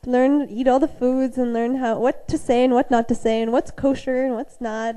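ordinary delivery

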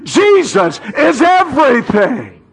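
shouted, high, with the whole church; rousing echo from the pulpit; call-and-response shout. Real, not acted. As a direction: lead the congregation in a loud cry. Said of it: "Come on, church, say it with me".

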